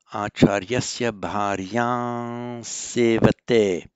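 Acharyasya bharya sevate, slow and deliberate; slow model reading. Shown as a demonstration. Teacher reading the Sanskrit answer to students. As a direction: read it out word by word for the class. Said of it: "model reading".

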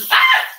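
very high short yelp; scared shriek. Real, not acted. Oil splatters, she yelps high and short.

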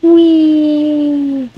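long, high squeal sliding gently down; playful whee. Real, not acted. Player having fun eating pumpkin, high drawn-out squeal.

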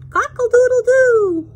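high, loud crow; rooster crow. Pretend. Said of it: "All the wonderful sounds".

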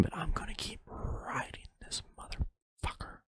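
very soft voiceless whisper. Pretend. Podcaster vowing to keep his ramble going, whispered. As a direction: whisper it right into the mic.

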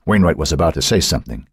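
plain reading voice

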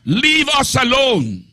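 high cry of the possessed man; demon crying out. Acted. Possessed man crying out at Jesus in the synagogue, high. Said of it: "he cried out".

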